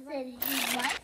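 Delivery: ordinary delivery, nothing marked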